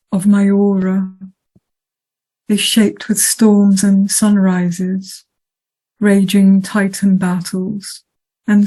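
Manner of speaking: measured, declaimed poetry reading with long pauses between lines; poem recitation. Acted. Poet reading Flying Sixteen to the audience, solemn recitation. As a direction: recite it evenly and deliberately, letting each phrase stand alone. Reads own poem after plain introduction.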